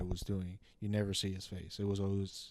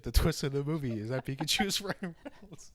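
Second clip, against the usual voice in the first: real laughter breaking into the words; cracking up; 4 semitones higher than usual. Real, not acted. Friend mocking the movie twist, cracking up. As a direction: laugh helplessly while getting it out.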